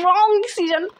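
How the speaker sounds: loud, high-pitched cry of bad decision; emphatic verdict. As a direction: call it out high and exasperated. Emphatic verdict on friend calling dad. (ज़ोर से, ऊँची आवाज़ में फ़ैसला सुनाते हुए)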